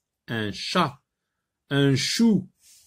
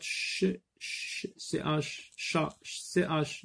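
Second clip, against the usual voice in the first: unvoiced, slow whispered "ch, C H"; 5 semitones higher, 6 dB quieter, slower than usual. Shown as a demonstration. Teacher whispering the ch sound to pupils. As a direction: whisper the ch and the letters slowly.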